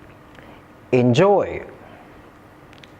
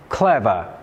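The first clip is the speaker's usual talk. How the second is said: "clever" said CLEV-er; "clever" with the weight up front, falling away; first-syllable stress; 7 semitones lower than usual. Shown as a demonstration. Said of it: "the other three nouns have stress on the first syllable".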